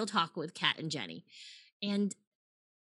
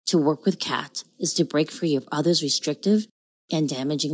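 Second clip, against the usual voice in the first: low, louder seductive announcer read; sexy announcer voice; 3 semitones lower, 6 dB louder than usual. Acted. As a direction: drop an octave and sell it. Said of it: "your sexy announcer voice".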